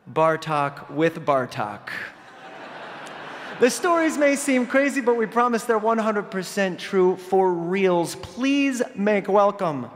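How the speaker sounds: usual speaking voice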